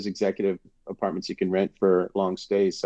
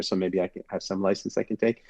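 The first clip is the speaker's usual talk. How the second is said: ordinary delivery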